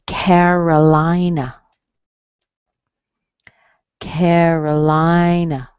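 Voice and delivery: Carolina drawn out, strong CARE and LIE; slow stress demo. Shown as a demonstration. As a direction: stretch Carolina slowly as CARE-uh-LIE-nuh. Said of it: "hear the first vowel and the third vowel".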